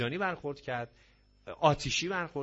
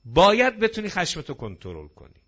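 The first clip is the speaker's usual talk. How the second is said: باید punched loud and high, then falling away; forceful باید opening a firm instruction; stress on باید; 3 semitones higher, 8 dB louder than usual. Real, not acted. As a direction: lean hard on باید at the start.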